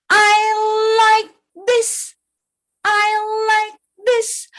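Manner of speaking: high horse voice, word by word; horse character. Acted. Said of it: "What did horse say?".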